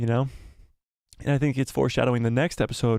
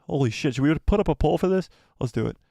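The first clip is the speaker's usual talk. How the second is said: normal talking voice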